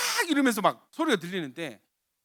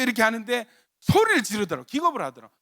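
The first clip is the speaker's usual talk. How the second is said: usual speaking voice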